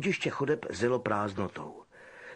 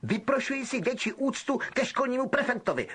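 higher outraged protest; pompous indignation; Percy demanding respect; indignant; 11 semitones higher than usual. Acted. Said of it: "Percy vypadal pobouřeně".

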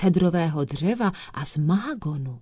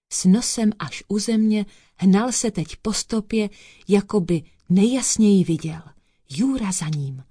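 ordinary delivery, nothing marked

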